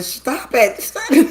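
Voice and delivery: high, loud mock scolding, rising on the repeat; imitating a scolding castmate. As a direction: mimic someone hissing at a panicky friend, playfully exaggerated. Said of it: "the one who said".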